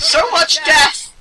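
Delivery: slow, high, loud lament; dramatic wail. Real, not acted. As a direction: draw out the cry of despair. Overwhelmed by mobs.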